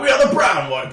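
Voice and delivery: high, loud fake-American accent; hammed-up, badly faked American. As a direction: announce it in a terrible American accent. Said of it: "these really bad American accents".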